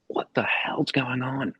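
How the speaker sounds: startled dad waking. Retold or imitated. Dad jolted awake by explosion.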